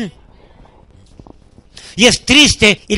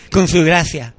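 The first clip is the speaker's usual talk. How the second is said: normal talking voice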